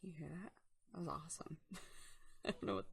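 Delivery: soft unvoiced whisper. Real, not acted. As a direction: whisper it so nobody hears. Whispering aside.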